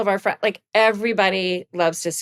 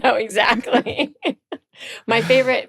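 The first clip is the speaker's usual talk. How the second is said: ordinary delivery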